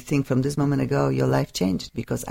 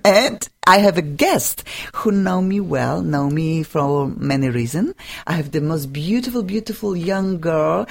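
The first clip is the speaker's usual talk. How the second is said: ordinary talk, nothing marked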